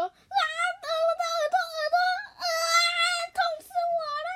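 crying out in pain. Acted. Ear bitten, crying in pain.

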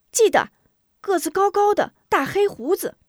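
put-on very high, loud child voice; eager boy. Boy describes the hero to grandmother, high eager voice. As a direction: pipe up brightly with the remembered details.

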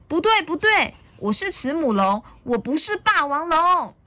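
put-on high, loud childish denial; acted storybook temper, pitched high; angry little dinosaur. A narrator's reading. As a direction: refuse it indignantly. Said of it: "小良生气了".

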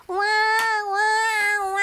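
high, loud sobbing wails; loud wailing cry. A narrator's reading. Narrator voices the penguins' wailing, loud and high. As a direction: wail like small crying penguins.

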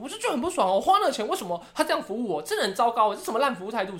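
high, huffy grumbling voice; imitating dad's complaint. Daughter mimics dad sulking about bad service, high and huffy. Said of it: "然后我爸就说".

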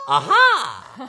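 loud high excited aha; exclaimed aha. Acted. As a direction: belt out a bright page-turn aha. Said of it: "Our page-turning sound today will be".

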